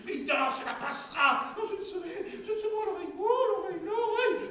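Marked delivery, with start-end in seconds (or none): 1.6-4.5 high, wailing woman's voice; wife's high moaning. Acted. Wife moaning to her husband about her ear, high. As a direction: moan about the ear, half laughing.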